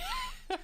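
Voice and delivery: a sudden high burst of laughter. Real, not acted. Laughing high at his own joke about the heir.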